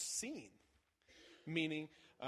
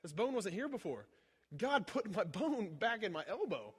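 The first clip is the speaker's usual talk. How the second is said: much higher and louder, thrilled disbelief; excited shouting; 8 semitones higher, 6 dB louder than usual. Retold or imitated. Retold healed woman shouting at her elbow, excited. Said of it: "she goes".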